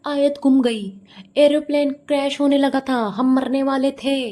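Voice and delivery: hammed-up alarm, drawing out the ending; mocking a sensational thumbnail; mock dramatic. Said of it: "ये thumbnail में क्या लगाएगी".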